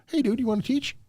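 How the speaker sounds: high-pitched put-on voice; casual offer imitation. Host imagines the owner recruiting the instructor, playful mimicry. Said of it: "Did he just go".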